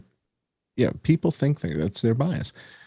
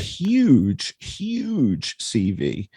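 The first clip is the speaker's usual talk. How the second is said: drawn-out sarcasm; 2 semitones higher, much slower than usual. Real, not acted. Sarcastic emphasis on Putin CV.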